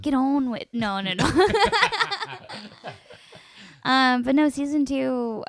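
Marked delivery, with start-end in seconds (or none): 0.0-0.7 "Get on with it" in a hammed-up Scottish accent, a bit higher and louder; playful Scottish accent, teasing her partner; mock Scottish accent. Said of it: "do that one more time". 1.3-3.4 laughing. Real, not acted. Laughing at own accent attempt.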